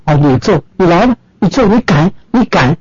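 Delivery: daring him, pitch up high; defiant dare. Retold or imitated. Wife taunting husband to hit her, defiant high dare. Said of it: "那做妻子就不服了".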